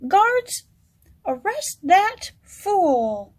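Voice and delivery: high, loud order, dragged slow; commanding shout. Acted. King orders his guards to arrest the jester, shouting. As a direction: call the guards in fury.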